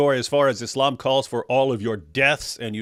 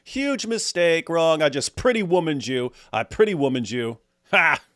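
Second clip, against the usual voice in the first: high, loud taunting gloat; gloating triumph; 6 semitones higher, 4 dB louder than usual. Pretend. Streamer mocking Canada like Pretty Woman, crowing gloat. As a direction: rub it in, crowing. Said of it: "And I'm like".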